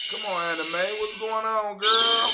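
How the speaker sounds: loud, high greeting shout; excited shout-out to a friend; calling out. Real, not acted. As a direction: holler a welcome across the room. Calls out to a guest arriving.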